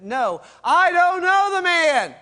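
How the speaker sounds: loud, high, slow furious shout; cursing denial. Retold or imitated. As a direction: bellow it to shut them up. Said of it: "throws out a four-letter word".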